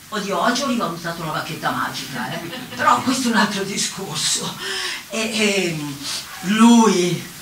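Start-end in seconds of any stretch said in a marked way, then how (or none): none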